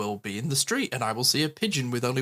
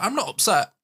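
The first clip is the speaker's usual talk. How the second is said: a mock-tearful child's protest, higher and louder; imitating teary daughter; 9 semitones higher, 4 dB louder than usual. Daughter in tears insists to parent she's not upset, mock-tearful. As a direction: pitch it up like a crying kid insisting. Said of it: "She's like".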